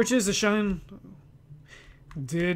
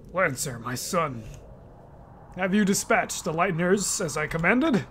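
low, soft, menacing king; king father; 4 semitones lower, 7 dB quieter than usual. Acted. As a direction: speak down to his son coldly.

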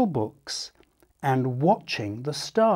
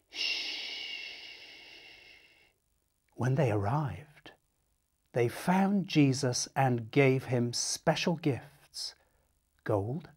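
soft, quiet reverent telling; gentle awed hush; 7 dB quieter than usual. A narrator's reading. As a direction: hush before the baby. Hush at the manger.

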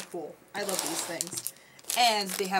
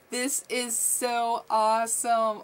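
ordinary delivery

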